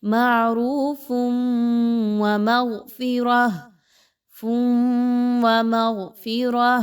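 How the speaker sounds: slow chanted tajweed with nasal merge; Quranic recitation with ghunnah. Shown as a demonstration. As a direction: recite slowly, holding the ghunnah. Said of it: "ادغام مع الغنہ یعنی ادغام with غنہ". (غنہ کے ساتھ تجوید میں تلاوت)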